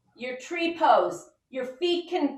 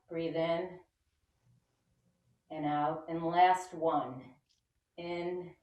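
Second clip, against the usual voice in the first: softer, fading breath cues; guided breathing; 7 semitones lower, 7 dB quieter than usual. Acted. Teacher guiding kids through deep breaths.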